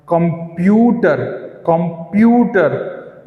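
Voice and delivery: Computer slowly, with a long stressed प्यू. Shown as a demonstration. Teacher showing students where stress falls, प्यू stretched in computer. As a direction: hold the प्यू in computer, then fall. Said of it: "Q पे पड़ रहा है".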